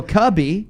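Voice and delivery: Cubby with a short u, KUH-bee; mispronounced surname. Retold or imitated. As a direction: misread it as Cubby, rhyming with hubby. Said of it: "look at it and go".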